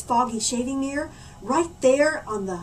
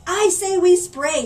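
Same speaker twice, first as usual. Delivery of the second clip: shouting; 6 semitones higher, 7 dB louder than usual. Acted. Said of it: "shouted Dad".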